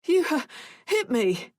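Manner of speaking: put-on a scared boy blurting it out through tears; child stammering as he starts to cry; whimpering child. A narrator's reading. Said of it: "he whimpered".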